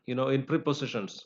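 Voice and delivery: usual speaking voice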